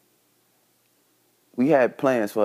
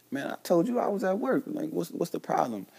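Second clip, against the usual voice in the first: pitched way up, mock-innocent denial; playing innocent; 9 semitones higher, 4 dB quieter than usual. To his son's mom on the phone, mock-innocent high denial. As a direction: lie sweetly, act like nothing happened.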